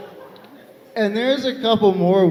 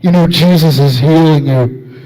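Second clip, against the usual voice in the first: loud, low stranger's voice; imitated zealot, low and forceful; voice from behind; 4 semitones lower, 7 dB louder than usual. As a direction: loom up behind someone with a pronouncement. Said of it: "I just hear this voice go".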